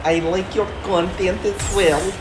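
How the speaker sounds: put-on slow, deadpan Danish accent; a Dane who sounds like he'd rather be elsewhere. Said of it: "I'm really enjoying hearing you practice your Boston accent".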